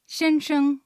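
先生 in Mandarin, xiānsheng with a level first tone on 先 and a short neutral 生; teacher models 先生 clearly for learners to repeat; Chinese drill word. Shown as a demonstration. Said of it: "では、練習してみましょう". (先生を中国語で、先は一声で平らに伸ばし、生は短い軽声で)

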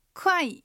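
快 with a falling tone; fourth tone. Shown as a demonstration. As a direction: start 快 high and let the pitch fall. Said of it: "快が下がる四声". (快を下がる四声で)

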